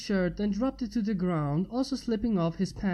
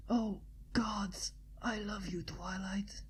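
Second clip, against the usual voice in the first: voiceless, much softer whisper; whispered mumble; 11 dB quieter, slower than usual. Acted. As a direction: barely breathe the confession. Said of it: "he mumbled".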